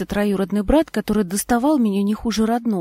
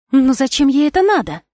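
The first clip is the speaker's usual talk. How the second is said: higher and louder exasperated question; almost shouting in disbelief; nearly raised voice; 6 semitones higher, 7 dB louder than usual. A narrator's reading. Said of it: "чуть тоже не перешла на повышенный тон". (почти на повышенных тонах)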